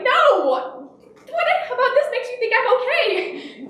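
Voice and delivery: high, drawn-out hammed-up child wail; mock sobbing. Injured son answering his worried mom, mock sobbing. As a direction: imitate an indignant kid wailing through tears.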